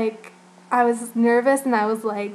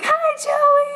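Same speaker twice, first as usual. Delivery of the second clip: much higher, loud squeal of a greeting; excited fan greeting; 6 dB louder than usual. Retold or imitated. Fan greeting Joey as he comes on stage, high excited call. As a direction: call his name across the room like a thrilled fan.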